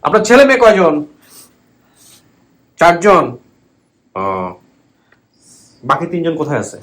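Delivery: loud, high questions; to someone hard of hearing. Real, not acted. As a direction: call out clearly to a deaf woman. আনো কম শোনেন.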